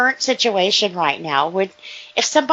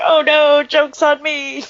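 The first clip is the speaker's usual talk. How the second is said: loud, higher mock wail, slowing at the end; mock dismay; 6 semitones higher, 6 dB louder than usual. Co-host imagining the prankster at the mirror, mock dismay. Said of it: "they looked in the mirror and they're like".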